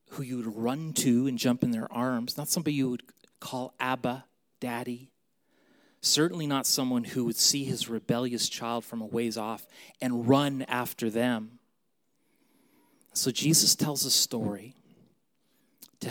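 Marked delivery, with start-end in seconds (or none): none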